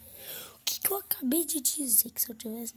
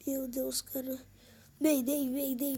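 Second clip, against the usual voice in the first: usual speaking voice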